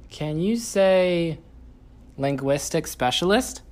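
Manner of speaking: slow, high sing-song prompt. Real, not acted. Coaxing toddler to repeat.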